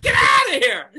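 high, loud shout; mock yell. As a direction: throw them out with a yell. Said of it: "And it's like".

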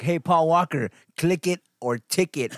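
high, loud, slow heckle at the screen; mocking heckle. Heckling the Fast Ten trailer, high and loud. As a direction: jeer it across the theater. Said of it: "I was like".

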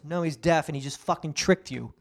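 ordinary delivery

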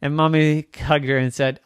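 ordinary delivery, nothing marked

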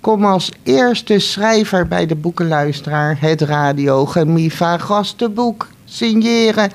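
ordinary delivery

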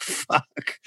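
unvoiced, breathy shocked swear; whispered curse. Guest recalling his reaction to the phone call, whispered swear. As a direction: whisper it under your breath.

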